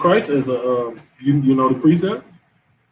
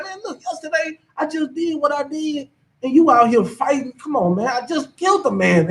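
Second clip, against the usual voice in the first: inner voice; 11 semitones higher than usual. Retold or imitated. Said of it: "I'm like".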